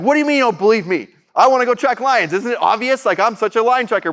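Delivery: replaying his own indignant outburst at the therapist; retold indignation. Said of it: "And I was so mad".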